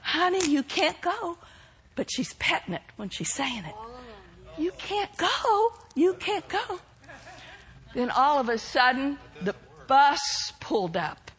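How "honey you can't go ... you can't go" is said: soft, petting voice; gentle coaxing. Retold or imitated. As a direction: coax softly while petting. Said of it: "she'd reach down and pet it".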